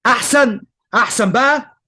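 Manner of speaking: أحسن punched high and loud; teacher driving home the claim; emphatic better. Real, not acted. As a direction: stress أحسن hard, then أحسن بقى. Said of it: "teacher stressing his point".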